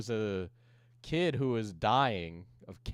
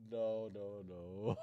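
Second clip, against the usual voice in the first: ordinary delivery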